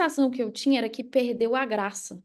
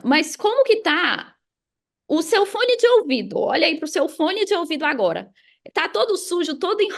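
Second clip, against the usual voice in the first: higher and louder, teasing mock reprimand; playful scolding; 5 semitones higher, 4 dB louder than usual. Real, not acted. Teasing the host about his headphones, playful higher scolding. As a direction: tease him like you caught him red-handed. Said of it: "Vai apanhar agora".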